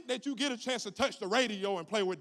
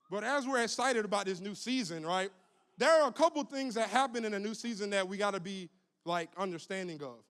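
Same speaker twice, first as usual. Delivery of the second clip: ordinary delivery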